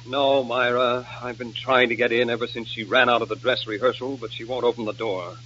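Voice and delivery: low, subdued report; dejected. Acted. Nicky locked out by Nora, dejected. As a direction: say it glumly, voice dropping away. Worried man outside dressing room.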